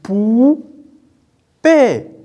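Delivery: teacher sounding out "pou" and "paix" separately; syllable by syllable. Shown as a demonstration. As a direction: break it apart as "pou" ... "paix". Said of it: "Parce qu'on entend".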